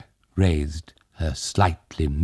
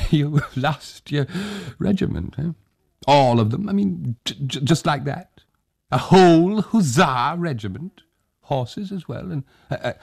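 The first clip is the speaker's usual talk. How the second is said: put-on high, stammering old man chuckling in disbelief; old Tag, amused disbelief; 9 semitones higher than usual. A narrator's reading. Old Tag teases the hussar about losing his regiment, amused. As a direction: marvel at the absurd news.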